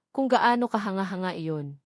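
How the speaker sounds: ordinary delivery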